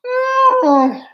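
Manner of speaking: a sudden very high pained cry; anguished cry. Real, not acted. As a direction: let out a real wail of despair. Fan crying out over loss.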